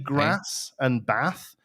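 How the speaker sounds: "grass" and "bath" with the short A of "cat". Shown as a demonstration. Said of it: "the same way you pronounce the A".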